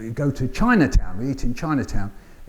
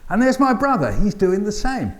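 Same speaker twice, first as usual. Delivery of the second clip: higher, lively storytelling lift; poem performance; 7 semitones higher than usual. Acted. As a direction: announce each family member brightly. Performing the hot potato poem.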